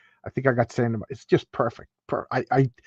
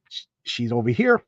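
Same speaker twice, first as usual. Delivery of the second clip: normal talking voice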